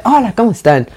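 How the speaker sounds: mock cheerful greeting. Fan contrasting [name] with a cheerful greeter, mock peppy hello. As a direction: bounce it like an overly happy host. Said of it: "no te las dice como feliz".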